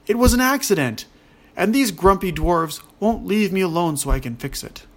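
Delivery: loud, high-pitched protest; indignant yell. A narrator's reading. Ogre protesting to the accusing dwarves, yelling. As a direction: yell it out in self-defense, voice jumping up. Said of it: "the ogre pushed himself forward and yelled".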